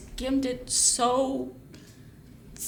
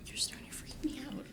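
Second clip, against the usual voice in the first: hushed unvoiced aside; whisper; 13 dB quieter than usual. Real, not acted. Friend whispering to the director, unnerved. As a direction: whisper so the actor can't hear.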